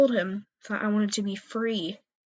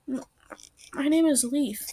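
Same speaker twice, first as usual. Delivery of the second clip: nervous stammer, a little higher; stuttering; 5 semitones higher, 4 dB louder than usual. A narrator's reading. Kit stammering her name to a strange cat, nervously. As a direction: stumble shyly on the first word. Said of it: "I stuttered".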